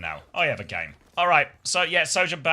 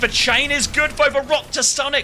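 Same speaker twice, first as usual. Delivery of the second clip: high, loud hype casting; excited play-by-play; 8 semitones higher, 9 dB louder than usual. Acted. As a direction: call the fight at full energy. Live play-by-play.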